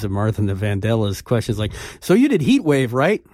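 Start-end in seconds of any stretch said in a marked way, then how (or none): 2.0-3.2 self-mocking naive question. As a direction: play the clueless young interviewer asking the obvious. Mocking own naive interview question.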